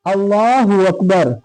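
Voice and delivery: slow takbir rising; prayer cadence; takbir recited. Shown as a demonstration. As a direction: draw out the takbir. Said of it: "फिर दूसरा करेंगे".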